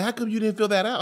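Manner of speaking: high, accusing question; sleepy mock tirade; going off. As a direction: go off at her, half asleep. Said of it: "I'm kinda going off".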